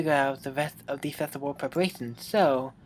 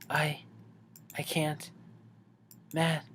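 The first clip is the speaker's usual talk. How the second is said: [name] whispering, overwhelmed; barely voiced, close and shaky; breathy whisper; 9 dB quieter, slower than usual. [name] whispers to Matt, overwhelmed.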